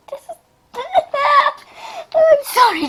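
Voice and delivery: crying with laughter, high apology; laughing. Real, not acted. Girl losing it over her own story, laughing hard. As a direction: laugh till it squeaks. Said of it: "this is hilarious".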